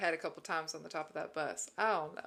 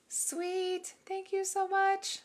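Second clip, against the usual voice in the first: excited thanks; 11 semitones higher, 5 dB louder, much slower than usual. Real, not acted. Thanking a viewer on stream.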